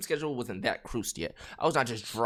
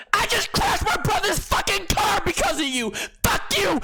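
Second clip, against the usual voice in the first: high, loud mock shriek; hysterical screaming; 7 dB louder than usual. Girl blames him for car crash, imitated screaming.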